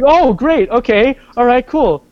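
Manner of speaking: a mother's high, bright "Oh, great" turning to tuition; mom's voice. Retold or imitated. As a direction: play the mom cheerful, then jump to the practical question. Mom reacting to acceptance news.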